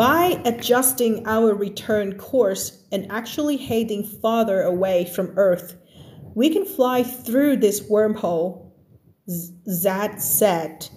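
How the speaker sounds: very slow, careful reading of the text; slow reading aloud. Acted. Teacher reads the story text slowly to her class. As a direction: read the passage word by word for learners.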